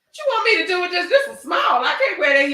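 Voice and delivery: high, loud, fast complaint about a tiny dress; outraged protest. Retold or imitated. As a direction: shout it out, half laughing. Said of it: "I said".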